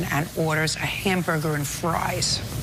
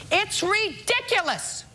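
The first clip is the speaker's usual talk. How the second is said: much higher, slow scornful verdict; sarcastic scolding tv judge; 13 semitones higher, slower than usual. Real, not acted. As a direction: drag it out in disbelief. Judge dismisses counterclaim.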